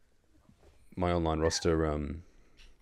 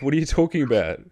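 surprised; 8 semitones higher, 6 dB louder than usual. Retold or imitated. Retold confused reply.

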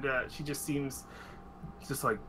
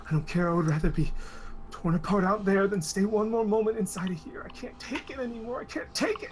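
louder, climbing to a high cracking cry; rising desperate outburst; 5 semitones higher, 4 dB louder than usual. Acted. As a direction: build from defiance to breaking. NPC breaking down.

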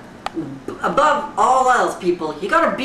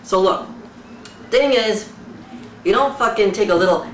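ordinary talk

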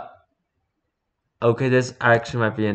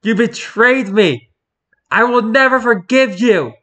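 furious villain shout; 10 semitones higher, 6 dB louder than usual. Acted. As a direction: scream it as a betrayed villain. Voicing betrayed villain meme.

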